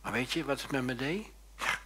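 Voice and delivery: ordinary delivery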